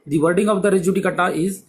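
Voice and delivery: usual speaking voice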